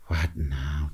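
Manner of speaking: soft, low "What now?" said under the breath; quiet aside. Retold or imitated. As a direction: keep "What now?" polite and hushed. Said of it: "Deep down, I'm like".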